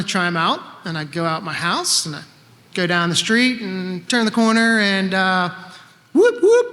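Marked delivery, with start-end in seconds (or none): 6.1-6.7 high whooping police siren; siren imitation. Storyteller imitating the police siren, high and loud. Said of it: "Wow, very good".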